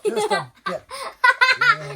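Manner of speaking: high laughing tease. Real, not acted. Dad laughing at daughter's practical joke. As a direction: laugh it out teasingly.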